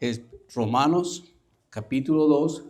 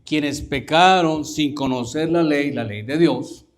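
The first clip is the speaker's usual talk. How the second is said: lectura bíblica lenta, fuerte y recalcada; relectura enfática; 2 semitones higher, 8 dB louder, slower than usual. Shown as a demonstration. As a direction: proclama el versículo despacio y con fuerza. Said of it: "Y te lo voy a decir así". (lectura enfática y pausada)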